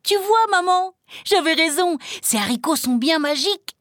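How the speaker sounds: put-on overjoyed boy, very high-pitched; gleeful, high boyish exclamation; jubilant. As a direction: crow triumphantly to mother. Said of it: "qui lui tomba dans les bras en jubilant".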